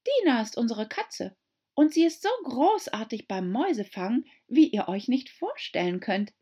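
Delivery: put-on high, eager raving girl. A narrator's reading. Alice to the animals, eager gushing. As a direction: gush about her cat. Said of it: "erwiderte eifrig".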